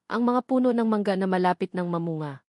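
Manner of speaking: ordinary talk, nothing marked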